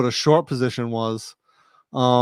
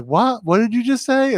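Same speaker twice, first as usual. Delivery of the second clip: high-pitched mock bafflement; baffled; 9 semitones higher than usual. Jib reacting to his slang word, baffled imitation. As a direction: imitate someone thrown by a strange word.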